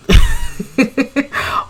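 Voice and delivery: very high burst of laughter; shrieking laugh. Real, not acted. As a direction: crack up at the scene. Laughs at the argument.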